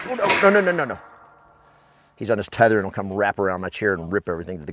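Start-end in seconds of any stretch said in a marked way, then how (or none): 0.1-1.0 scolding the dog. Real, not acted. Scolding the dog mid-sentence.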